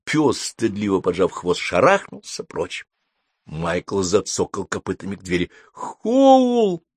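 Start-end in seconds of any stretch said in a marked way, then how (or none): 5.7-6.8 very high, long happy call; overjoyed cry. A narrator's reading. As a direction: cry out the name in delight. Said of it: "обрадовался он". (радостный высокий возглас)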